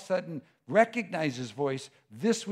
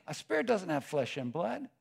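usual speaking voice

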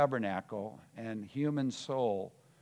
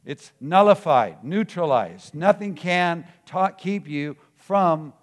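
loud, higher-pitched preaching with heavy punches; full-force preaching; 5 semitones higher, 10 dB louder than usual. Real, not acted. Preacher telling believers the vote against them is rigged, forceful preaching. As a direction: drive every phrase home at full volume.

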